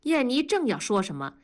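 ordinary delivery

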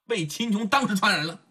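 usual speaking voice

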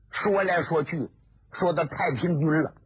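usual speaking voice

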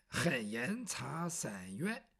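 low, softly drawn-out petition cry; demonstrated slow cry. Shown as a demonstration. Bai Yutang shows Yumo how to cry grievance, slowly drawn out. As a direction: stretch each word out slowly, keeping it low. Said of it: "就这么喊".

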